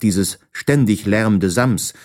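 ordinary delivery

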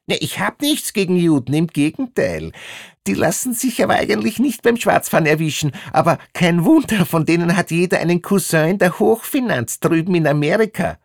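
high, fast, piercing rant; put-on high-pitched bigot in full flow; prejudiced female inspector. Inspector rants her prejudices.

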